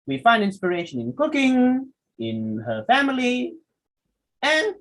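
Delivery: "cooking", "family", "and" each held high, with pauses; pitch goes up. Shown as a demonstration. Teacher showing a list not done yet, pitch rising. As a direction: keep "cooking" and "family" up in the air, unfinished. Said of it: "your pitch will go up".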